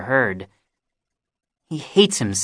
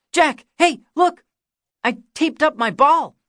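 put-on high, loud pleading little-boy call; boy desperate to be noticed; eager kid; 5 dB louder than usual. A narrator's reading. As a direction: beg for attention like a tagalong kid. Kiki chasing Jack.

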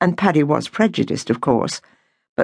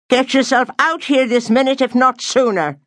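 stern scolding; 7 semitones higher than usual. Acted. Gran scolding through shed door.

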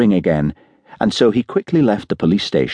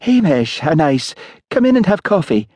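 high, airy, absent welcome; vague welcome; 10 semitones higher than usual. A narrator's reading. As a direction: greet a visitor vaguely in a raised pitch. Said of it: "said vaguely".

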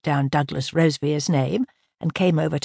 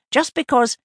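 higher, cut-off angry protest; 8 semitones higher, 4 dB louder than usual. Acted. Nick defending Eleanor before she hushes him, angry. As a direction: push on, then get cut off. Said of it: "said Nick angrily".

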